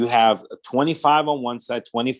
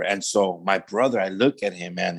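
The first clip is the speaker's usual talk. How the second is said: usual speaking voice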